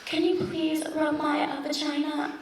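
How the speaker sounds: soft, hesitant request; awkward bedroom ask. Pretend. A woman trying the word on her lover, halting. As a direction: ask shyly, stumbling before vagina. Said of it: "if you tried to use it in the bedroom".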